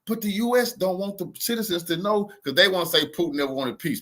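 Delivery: ordinary talk, nothing marked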